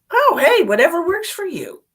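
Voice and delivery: high, loud burst of cheer; host answering a viewer's career news, bright and loud; excited reply. Real, not acted. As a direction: sing out encouragement. Answering a viewer's chat message.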